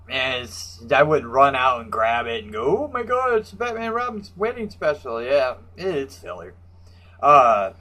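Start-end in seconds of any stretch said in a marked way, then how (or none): 2.8-5.2 a high, sarcastic burst of fake excitement; high-pitched fake awe; mock gushing fan. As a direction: play the overexcited fanboy, mockingly. Mock gushing fan reaction quote.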